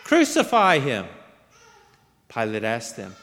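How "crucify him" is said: loud, raised cry of a mob; high, forceful narrated shout; crowd shout. A narrator's reading. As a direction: shout it back as an angry crowd would. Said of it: "They shouted back".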